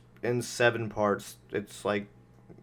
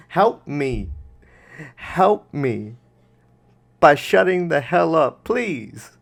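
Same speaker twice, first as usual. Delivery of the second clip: loud begging, building to a high shout; exasperated mock plea; 5 semitones higher, 8 dB louder, slower than usual. Host begging the video's speaker to shut up, exasperated. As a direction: plead loudly, then explode in exasperation.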